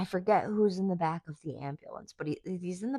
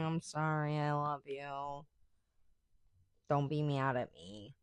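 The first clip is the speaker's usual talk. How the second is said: much softer, sheepish aside; mock apology; 2 semitones lower, 10 dB quieter, slower than usual. Vlogger softly apologizing to her listening boss. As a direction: murmur a cheeky apology.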